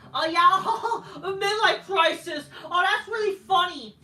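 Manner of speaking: high, loud sarcastic laugh; sarcastic laughing reaction; mocking laughter. Real, not acted. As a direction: laugh at the joke to show it is lame. Laughing at promo insult.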